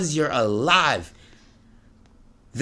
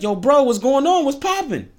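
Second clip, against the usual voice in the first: Timon's voice; 11 semitones higher, 5 dB louder than usual. Retold or imitated. Timon panicking at Pumbaa.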